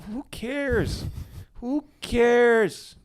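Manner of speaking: high-pitched, drawn-out rhetorical question; exasperated dismissal. Real, not acted. Comedian to his critics about offence, exasperated dismissal. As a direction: brush the critics off, stretching it out high. Brushes off those offended by jokes.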